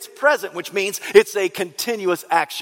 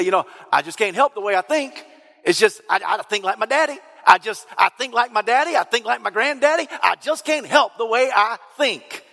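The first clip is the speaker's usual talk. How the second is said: higher, whiny Southern hillbilly voice; 4 semitones higher than usual. Retold or imitated. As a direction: play a stubborn hillbilly defending his daddy's ways. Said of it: "all my impersonations sound like a, you know, a redneck hillbilly".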